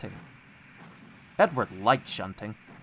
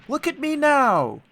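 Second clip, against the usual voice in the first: high, slow, delighted call; proud and happy; 13 semitones higher, 7 dB louder than usual. A narrator's reading. Little engine showing off to the others, high and happy. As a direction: show off joyfully. Edward proudly puffs away.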